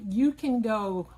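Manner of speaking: normal talking voice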